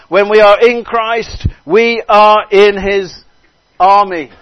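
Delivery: loud, high, rousing preacher; full-force preaching. Real, not acted. Preacher proclaiming God's miracle to the congregation, full-force preaching. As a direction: proclaim it with rising force, stretching key words.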